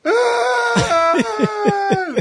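very high, long crying wail; mock wail. Kid imitated bawling over the toilet paper cake, long high wail. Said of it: "he just went".

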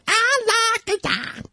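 high puppet voice; jerky wooden puppet voice; Howdy Doody puppet voice. Acted. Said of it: "in a Howdy Doody puppet fucking voice".

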